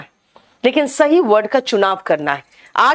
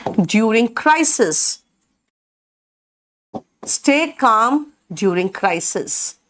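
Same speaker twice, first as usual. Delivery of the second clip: very slow, careful English; slow model sentence; much slower than usual. Shown as a demonstration. Teacher showing students a phrase, very slow. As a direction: lay each word out for learners. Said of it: "तो एकदम शांत रहो".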